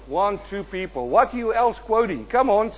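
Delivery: ordinary talk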